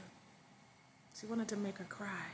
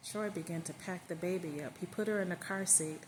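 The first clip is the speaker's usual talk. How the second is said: usual speaking voice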